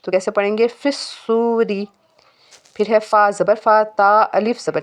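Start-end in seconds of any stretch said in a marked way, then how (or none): 0.7-1.9 फिस्सूरी with long सू and light, short री; slow फिस्सूरी, brief light ending; thin short raa. Shown as a demonstration. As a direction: keep the r of फिस्सूरी thin and don't stretch its vowel. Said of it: "रा को हमने लंबा नहीं करना".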